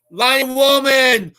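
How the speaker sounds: slow, loud name call; announcing a name. Acted. Host calling out a viewer joining, slow loud call.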